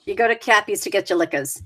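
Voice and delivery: quick Boston speech, R's dropped; flat New England Boston vowels; Boston accent. Shown as a demonstration. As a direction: demonstrate broad Boston, "liquors" ending in "ahs". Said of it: "you have the Boston accent".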